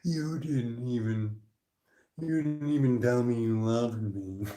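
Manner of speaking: slow, soft, wounded guilt trip; pretend-hurt sulk, softly drawn out; mock passive aggressive. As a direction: play at passive aggression, dragged out quietly. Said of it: "I'm gonna be passive aggressive for a second".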